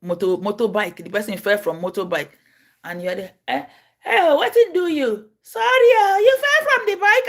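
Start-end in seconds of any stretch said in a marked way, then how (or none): 3.5-7.3 high, loud, tearful wailing plea; mock wailing victim. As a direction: wail like a hurt man begging for help. Mimicking a crying accident victim.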